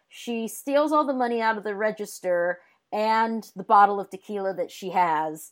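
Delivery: usual speaking voice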